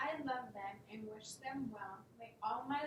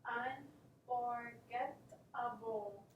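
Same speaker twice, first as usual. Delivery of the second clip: each syllable said slowly and apart; student slowly sounds out a hard word; sounding out syllables; much slower than usual. Shown as a demonstration. Student sounds out the word for the teacher, slow and syllable by syllable.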